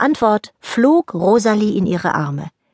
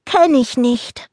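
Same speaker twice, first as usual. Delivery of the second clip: sleepy grumble; 6 semitones higher, slower than usual. Acted. Niki grumbles from bed.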